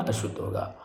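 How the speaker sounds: usual speaking voice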